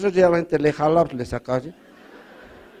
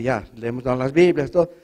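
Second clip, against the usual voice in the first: ordinary delivery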